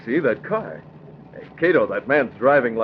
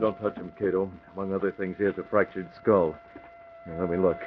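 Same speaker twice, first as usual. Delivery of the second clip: quiet and careful; 2 semitones lower, 7 dB quieter than usual. Acted. Examining an injured man.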